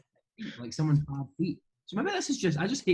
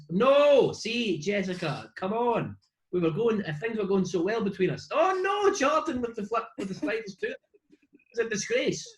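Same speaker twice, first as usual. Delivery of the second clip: mock exasperation; 7 semitones higher than usual. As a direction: play up a scandalized protest. Mock outburst at someone's footwear.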